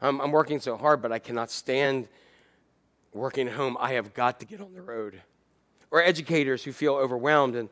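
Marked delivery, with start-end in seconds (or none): 0.0-5.2 weary frustrated worker. Retold or imitated. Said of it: "people working from home said".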